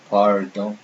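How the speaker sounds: "pardon" with untrilled English R. Shown as a demonstration. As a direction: say "pardon" wrongly with tongue curled back. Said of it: "I didn't say".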